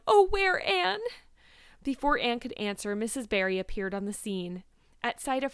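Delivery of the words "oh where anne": loud, very high acted wail; sobbing. A narrator's reading. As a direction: cry it out, distraught. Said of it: "sobbed Carrie Sloane".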